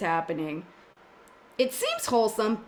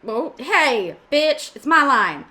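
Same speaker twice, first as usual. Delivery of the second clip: mock indignant; 3 semitones higher, 6 dB louder, slower than usual. Real, not acted. Player protests stolen line.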